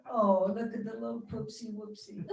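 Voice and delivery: put-on baby talk to a pet, laughing; childish pet talk. Woman imitating how she talks to her cats, cooing baby voice. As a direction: coo at a kitten through a laugh. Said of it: "speak in a childish voice".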